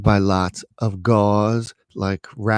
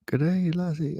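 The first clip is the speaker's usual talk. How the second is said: higher, badly faked Irish lilt; attempted Irish brogue; 7 semitones higher, 5 dB quieter than usual. Imitating the Irish nurse, shaky attempt at Irish brogue. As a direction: have a go at an Irish accent. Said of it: "I can't do the Irish".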